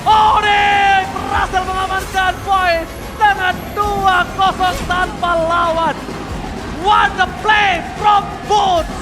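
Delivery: loud, high, drawn-out hype shouts; fighting game announcer. Real, not acted. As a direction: stretch every call like a finals announcer. Game-winning teamfight call.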